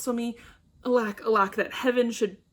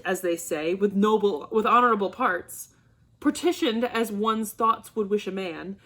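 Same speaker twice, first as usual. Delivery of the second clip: ordinary delivery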